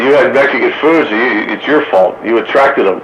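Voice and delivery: ordinary talk, nothing marked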